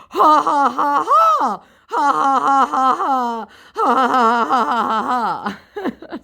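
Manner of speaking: high, loud ha-has that crack into real giggles; imitating the scammer's laugh until she breaks up laughing; mock laugh breaking into real laughter. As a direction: start mocking him and lose it yourself. Long scammer laugh, speaker cracking up.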